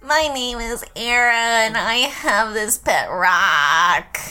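slow, drawn-out character voice; slow self-introduction in character; trying out character voice. Acted. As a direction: stretch the words out in a put-on voice. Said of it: "I don't know my voice".

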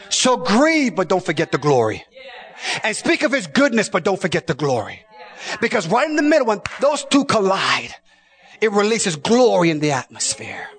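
high, ringing preacher's call; preaching at full force. Acted. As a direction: lift the congregation with it. Preacher shouting.

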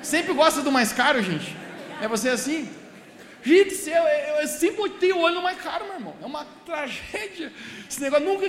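loud, rousing call to the crowd; loud, laughing, fired-up preacher; excited preaching. Real, not acted. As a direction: pump up the congregation.

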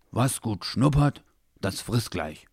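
slow, sulky proverb; offended mumble. A narrator's reading. Offended Labrador defending his appetite, sulky mumbling. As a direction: mutter it slowly, a touch huffy. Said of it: "Beleidigt dreinblickend murmelte der".

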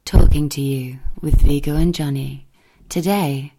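loud, slow, rich announcer intro; a warm posh podcast ident, slow; warm posh voiceover. Shown as a demonstration. The voice actor records the podcast intro, warm posh announcer. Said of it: "a lot of warmth".

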